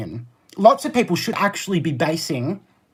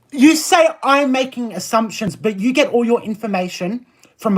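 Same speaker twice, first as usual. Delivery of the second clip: heated; 6 semitones higher, 3 dB louder than usual. Real, not acted. Heated argument with chat viewer.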